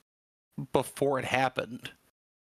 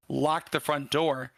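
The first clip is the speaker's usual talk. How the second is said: normal talking voice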